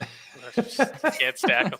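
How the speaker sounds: slow, laughing through the words. Real, not acted. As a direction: chuckle while you say it. Joking about stacking dice.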